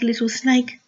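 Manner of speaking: usual speaking voice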